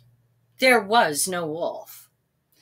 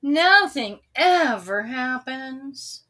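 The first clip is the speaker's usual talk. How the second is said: bored moan; 4 semitones higher, 6 dB louder, slower than usual. A narrator's reading. Sam bored on the hill.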